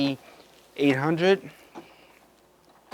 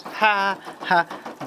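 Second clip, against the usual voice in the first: two deliberate, spaced-out ha's; mock laugh at your own weak pun; higher-pitched, put-on ha ha; dry fake laugh; 7 semitones higher than usual. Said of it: "Dry jokes".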